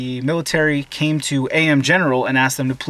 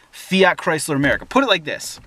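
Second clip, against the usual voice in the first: ordinary delivery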